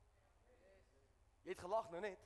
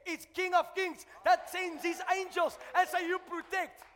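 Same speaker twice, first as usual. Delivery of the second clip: loud, rising sermon shout; full-force preaching; 8 semitones higher, 13 dB louder than usual. Acted. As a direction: preach it out, roaring to the church.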